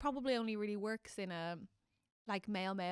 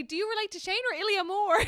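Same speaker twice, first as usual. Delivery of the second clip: ordinary talk, nothing marked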